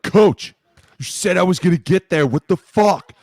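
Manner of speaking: high, loud, indignant whine; mock angry complaint. Frustrated defender yelling at his coach after a failed blitz. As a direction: yell at the coach, betrayed.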